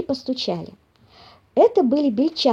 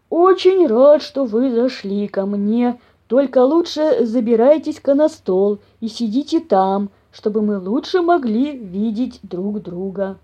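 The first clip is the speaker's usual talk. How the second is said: hospitable big bear; slower than usual. A narrator's reading. Papa bear inviting mice onto the table, ponderous. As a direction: welcome them ponderously.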